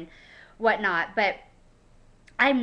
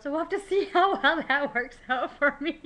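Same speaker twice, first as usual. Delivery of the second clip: laughing; 6 semitones higher than usual. Real, not acted. Laughing at her own soccer plans.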